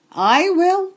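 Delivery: a hungry pig jumping at food; eager pig voice. Acted.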